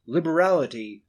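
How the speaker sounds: usual speaking voice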